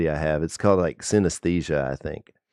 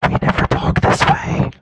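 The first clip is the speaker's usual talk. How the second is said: breathy whisper up close; 5 dB louder than usual. Shown as a demonstration. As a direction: whisper it right into the mic. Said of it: "It's so intimate".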